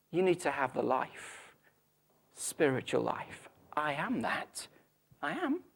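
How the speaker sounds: slow, measured, quiet statement of who he is; solemn declaration. Acted. Preacher voicing Jesus's claim to be the way, solemn and measured. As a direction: let each claim land softly and slowly. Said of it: "he's saying".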